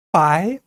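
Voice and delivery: 바이 pitched upward in a second tone; 바이 with a clear rising tone. Shown as a demonstration. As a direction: say 바이 climbing like a question. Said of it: "올라가는 제이성이고요".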